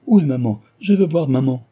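loud, high pleading cry. A narrator's reading. Girl begs her mother's friend, loud and pleading. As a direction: demand to see mother, near panic. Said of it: "en s'écriant".

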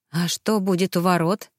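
plain reading voice

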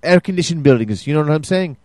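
poor mock Russian ad read; bad Russian accent. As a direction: read the care sheet in a hammy Russian accent. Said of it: "you gotta work on your Russian".

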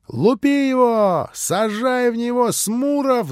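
high, drawn-out rallying yell; shouting boys. A narrator's reading. Schoolboys urging Smurov to throw stones, shouted. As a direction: yell it long and high like a mob of kids. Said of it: "закричали все".

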